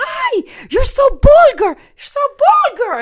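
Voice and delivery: put-on shrieked, very high Spanish-accented outrage; mother's Spanish accent. Mother scolding her daughter, outraged Spanish accent. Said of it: "And then her Spanish accent".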